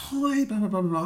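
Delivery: high-pitched imitated phone greeting; phone counsellor. Counsellor ringing to check on a contestant, high greeting. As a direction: imitate a caller's high, airy hello.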